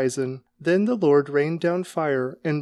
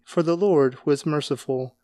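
ordinary delivery

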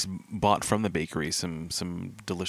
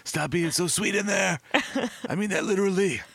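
higher, commanding agent; bossy agent voice; 12 semitones higher than usual. Retold or imitated. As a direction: order them out like a cop. Voicing an agent in the story.

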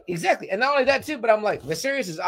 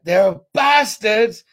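"They're bastards" slowed, louder and higher, each word hit; emphatic bastards; 5 semitones higher, 7 dB louder, much slower than usual. Retold or imitated. Retelling how the brother insults the princes, words hammered. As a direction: lean hard on "bastards". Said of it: "puts emphasis on".

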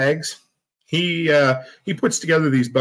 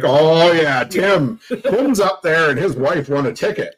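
mock groaning; 3 semitones higher, slower than usual. Imitating the crowd at the raffle, mock groaning. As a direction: mimic a groaner, half laughing. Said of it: "there was a couple of groans".

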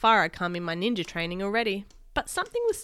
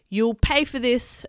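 slow, loud, higher threat; drawn-out miniature villain's threat; shrill little screech; 4 semitones higher, 6 dB louder, slower than usual. Acted. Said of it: "a shrill little voice screeched".

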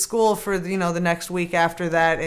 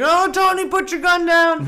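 police pleading; 9 semitones higher, 5 dB louder than usual. Retold or imitated. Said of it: "they're like".

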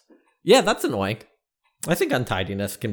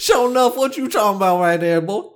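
higher, hammed-up Southern accent; mock Southern twang; 10 semitones higher than usual. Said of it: "You got a twang".

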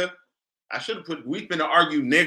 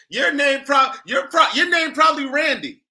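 high, loud jab at the host; loud playful roast; teasing shout; 9 semitones higher, 6 dB louder than usual. Real, not acted. Teasing host about names.

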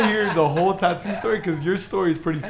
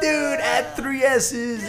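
joking shout; 7 semitones higher, much slower than usual. Pretend. Joking shouted tattoo advice.

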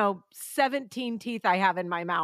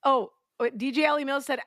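ordinary talk, nothing marked

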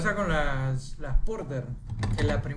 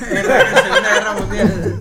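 laughing; 7 dB louder, slower than usual. Real, not acted. Group cracks up at the claim.

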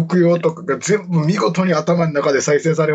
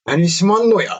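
Shimaki's catchphrase; 6 semitones higher than usual. Retold or imitated. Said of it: "って".

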